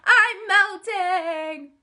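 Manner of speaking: high drawn-out witch wail; melting witch wail. Acted. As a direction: wail it out, slowly dissolving.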